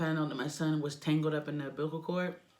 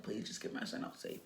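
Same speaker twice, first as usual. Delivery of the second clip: hushed, much lower, whispered plea; pleading whisper; 9 semitones lower, 12 dB quieter than usual. Retold or imitated. Mother begging the doctor to save her son, whispered plea. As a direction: beg the doctor under your breath. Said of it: "I'm looking at him like".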